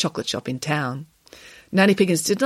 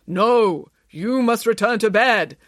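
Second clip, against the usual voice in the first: higher, slow, forceful order; firm; 5 semitones higher, 3 dB louder, slower than usual. A narrator's reading. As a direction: insist slowly, allowing no argument. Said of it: "said Samantha firmly".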